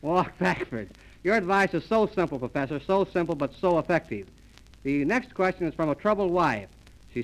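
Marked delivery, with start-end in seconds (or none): none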